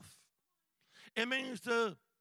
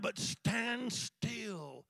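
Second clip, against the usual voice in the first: Fear not drawn out slowly, level voice; slow and steady; 2 semitones higher, much slower than usual. Shown as a demonstration. Preacher slowing the verse down, calm and slow. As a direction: let it settle, slow.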